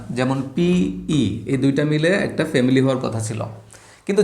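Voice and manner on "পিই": পিই stretched slowly, letter by letter; drawn-out sounds. Shown as a demonstration. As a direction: join পি and ই slowly. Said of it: "consonant দেখবে যে একটা vowel".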